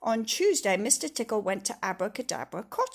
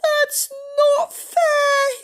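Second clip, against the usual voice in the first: much higher, louder, slow crying whine; whiny wail; 12 dB louder, much slower than usual. Acted. Mister Tickle protests to Miss Magic, crying. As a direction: wail like a thwarted child. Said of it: "he cried".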